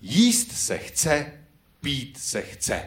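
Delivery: slow, spelled-out lyric line; teaching the hall the words, slow and clear; slow demo of the lyric. Shown as a demonstration. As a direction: dictate the refrain slowly to the crowd. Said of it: "zapijeme text".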